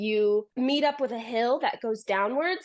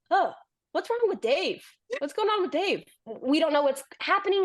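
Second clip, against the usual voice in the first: mock worried brothers; 5 semitones higher, faster than usual. Brothers voiced asking about Dave.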